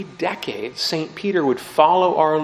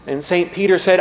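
usual speaking voice

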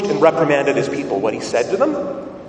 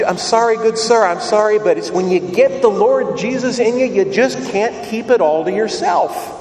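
higher-pitched voice of an overjoyed worshipper; fervent churchgoer; 5 semitones higher than usual. Retold or imitated. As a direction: play a gushing, apologetic believer who can't contain herself. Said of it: "And she says".

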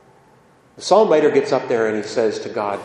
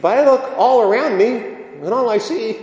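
bewildered psalm writer; 8 semitones higher than usual. Acted. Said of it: "the psalm writer says".

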